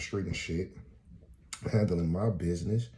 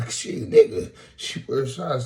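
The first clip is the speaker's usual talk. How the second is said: mocking guy; 8 semitones higher, 8 dB louder than usual. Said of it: "He was like".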